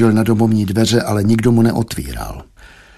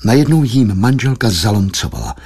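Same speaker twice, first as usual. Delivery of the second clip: ordinary delivery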